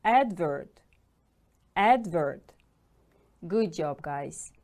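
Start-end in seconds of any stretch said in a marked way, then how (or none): 0.0-0.8 "Advert" punched on AD; first-syllable stress. Shown as a demonstration. Teacher models the word for repetition, "Advert" stressed firmly on AD. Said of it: "The stress is on the first syllable". 1.7-2.5 "Advert" punched on AD; first-syllable stress. Shown as a demonstration. Teacher models the word for repetition, "Advert" stressed firmly on AD. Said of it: "The stress is on the first syllable".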